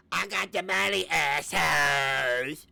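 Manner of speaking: loud, drawn-out sneering yell, slow at the end; hammed-up boast of a rich jerk; mock shout. Mocking Hussey's boast.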